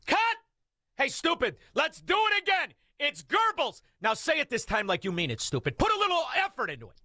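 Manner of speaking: high-pitched exasperated director yelling at an actor; mock film director. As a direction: play an irate director, pitch jumping way up. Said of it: "when you don't have a director".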